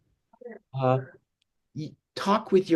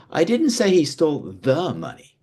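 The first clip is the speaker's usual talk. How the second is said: "the" punched as a full, separate word; stress on THE; 5 semitones higher, 4 dB louder than usual. Shown as a demonstration. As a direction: hit "the" before "money". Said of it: "by how you say each individual word".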